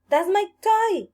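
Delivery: high, loud protest; indignant shout. A narrator's reading. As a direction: yell it like a wronged child. Child protests to witch.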